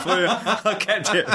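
laughing. Real, not acted. To the interviewer about the old script, laughing. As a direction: laugh as you confess it.

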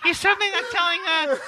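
Kathy Griffin. Acted. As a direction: push the pitch up and lean on the key words. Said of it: "I demand you do the Ka-, Kathy Griffin voice".

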